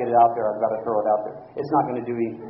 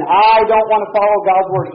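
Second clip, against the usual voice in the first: mock defiant shout; 5 semitones higher, 7 dB louder than usual. Voicing a rebel refusing.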